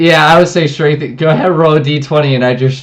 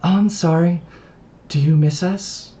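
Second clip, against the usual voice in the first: put-on girl's voice; 6 semitones higher than usual. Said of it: "You can see the girl now".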